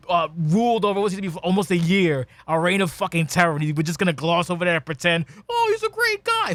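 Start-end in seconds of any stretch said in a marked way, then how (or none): 5.5-6.5 much higher, sarrowly sarcastic fake praise; mocking fan voice. Mocking fans who forgave the villain, in a high sarcastic voice. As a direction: squeak it out like a naive fan.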